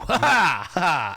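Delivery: loud, high mocking laugh; gloating laugh. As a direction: crow over the heroes. Villain gloating at delaying.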